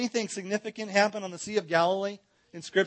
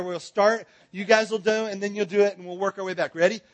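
normal talking voice

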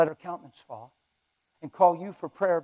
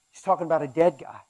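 ordinary delivery

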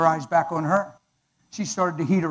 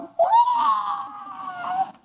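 shocked what. Retold or imitated. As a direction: cry out in disbelief. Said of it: "And I went".

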